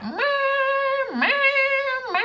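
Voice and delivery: high sung meows like honking horns; mock car-horn meowing; imitating car horns. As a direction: mimic the horns as shrill meows. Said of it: "all I could hear was like".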